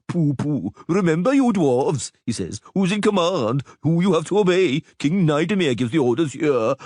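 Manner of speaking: mock the stuck-up flunky; dwarf mocks the king's pompous flunky; pompous courtier. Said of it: "nose stuck up towards the heavens".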